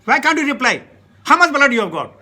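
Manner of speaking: a retold scolding shout from the guru. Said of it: "He shouted at me".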